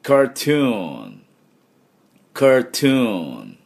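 slow "カートゥーン" for repeating, long oo; slow, careful English "カートゥーン"; model "カートゥーン". Shown as a demonstration. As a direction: stretch "トゥーン" in "カートゥーン". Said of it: "私の後で発音いきましょう".

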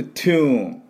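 「トゥーン」の頭を息で破裂させる; 唾を飛ばす勢いで「トゥーン」、高めに; 唾を飛ばすTの音. Shown as a demonstration. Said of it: "唾を飛ばす音ですね". (「トゥーン」を英語のT音で長く)

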